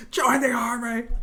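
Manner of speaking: hammed-up army recruiting holler; loud recruiter yell. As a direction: call it out like a blunt recruiter. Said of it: "Just, like, really loud".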